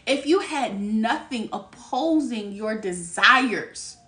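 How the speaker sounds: slow, drawn-out reading of the card; slow emphatic repeat. Shown as a demonstration. Repeating the card's message to viewers, slow. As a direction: let the words land slowly. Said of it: "repeats card line slowly".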